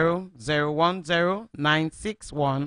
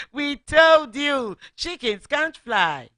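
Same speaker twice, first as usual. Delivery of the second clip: much higher, loud, slow gloating jeer; taunting chickens; 9 semitones higher, 5 dB louder, slower than usual. Acted. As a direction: rub it in like smug bullies. Chickens taunting fallen Daisy.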